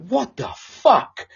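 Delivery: drawn-out, stunned what the fuck; slow mock disbelief at himself. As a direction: let the shock stretch slowly. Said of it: "I was like".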